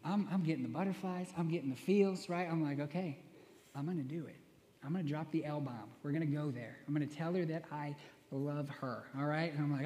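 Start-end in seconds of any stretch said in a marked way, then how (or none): 2.8-9.0 soft, low, psyching himself up under his breath; a hushed private pep talk; quiet inner monologue. Retold or imitated. Inner monologue before confessing.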